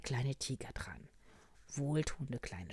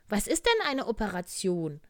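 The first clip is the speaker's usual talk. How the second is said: high, loud, curious bear; little bear asking; 8 semitones higher, 11 dB louder than usual. Acted. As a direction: pipe up with a wide-eared question. (hohe, laute Bärchenfrage)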